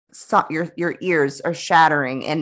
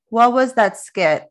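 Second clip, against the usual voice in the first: ordinary delivery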